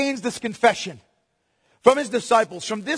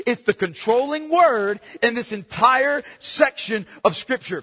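normal talking voice

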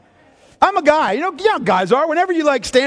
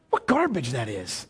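disgusted; 10 semitones lower, 8 dB quieter than usual. Real, not acted. Preacher scorns religion, low aside.

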